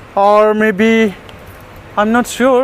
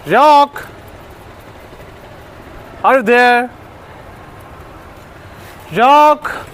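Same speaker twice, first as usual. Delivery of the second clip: high, raised-voice call for a lost dog; searching shout, pitched well up; 5 semitones higher, much slower than usual. Real, not acted. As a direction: call out to find him. Calling from the terrace.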